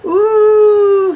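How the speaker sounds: high, loud squeal; coach screaming. Acted. The frightened coach squeals on the curves, high and loud. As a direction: shriek as the wheels grind. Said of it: "screamed the coach".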